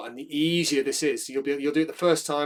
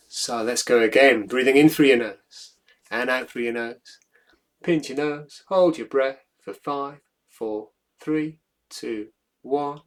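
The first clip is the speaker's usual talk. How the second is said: louder cues, then a slow count down; guided breathing countdown; 8 dB louder, slower than usual. Acted. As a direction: count them down steadily.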